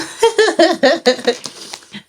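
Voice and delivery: high, amused laugh; long high giggle. Real, not acted. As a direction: laugh out in delight. Listener reacting to accent demo.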